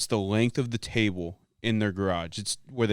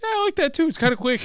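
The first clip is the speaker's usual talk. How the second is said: high-pitched agreeing voice, imitated; quoted sidekick. Retelling the sidekick's reply to the comedian, high imitated voice. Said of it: "And Ben goes".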